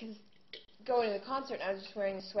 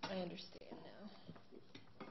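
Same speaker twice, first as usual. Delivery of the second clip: much softer, slow, breathy whisper; whispered aside; 13 dB quieter, much slower than usual. Real, not acted. Singer quietly sympathizes with fellow contestant, whispering. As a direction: confide it under your breath. Sympathy over dealing with Mary.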